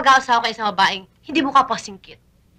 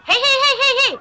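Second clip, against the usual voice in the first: high, rapid-fire heys; scolding; 11 semitones higher, 3 dB louder than usual. Acted. Woman tells off her teasing visitor, scolding. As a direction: call him to order with high heys.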